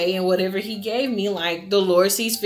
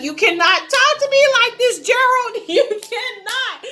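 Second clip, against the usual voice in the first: shrieking high and loud at her dad, then laughing; indignant, way up in pitch; retelling her outraged high-pitched protest to Gerald; outraged high yell; 13 semitones higher, 5 dB louder than usual. Retold or imitated. Outraged retort quoted to her dad.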